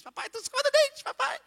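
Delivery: imitating daughter Maria waking dad; little girl voice. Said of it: "a vozinha". (imitando a vozinha da filha, bem aguda)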